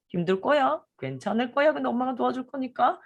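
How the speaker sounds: put-on reassuring to toddler. Shown as a demonstration. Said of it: "이렇게 또".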